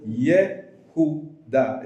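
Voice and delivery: Yehuda stretched out slowly; teacher modelling Yehuda slowly; slow model word. Shown as a demonstration. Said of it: "would be a better transliteration".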